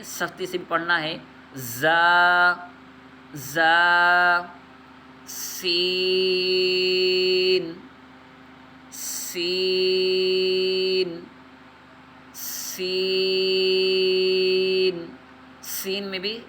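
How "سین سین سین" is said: سین stretched very long with a hissing s; سین with a long sharp hiss; whistling s. Shown as a demonstration. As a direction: let سین whistle, drawn out. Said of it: "سیٹی کی آواز پائی جائے گی".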